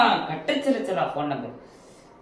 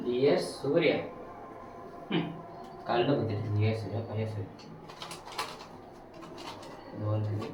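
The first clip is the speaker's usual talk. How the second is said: mock-solemn reading; 8 semitones lower, 11 dB quieter than usual. Reading the note, low and quiet.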